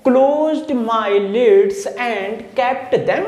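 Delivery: slow, careful reading of the line; slow example reading. Shown as a demonstration. Teacher slowly reading the example line. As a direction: read the line out slowly for the class. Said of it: "closed सी आ रहा है".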